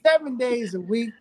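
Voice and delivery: half-laughing mock indignation at a four o'clock text; laughing retold outburst. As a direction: laugh through the line in fond disbelief. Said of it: "I said".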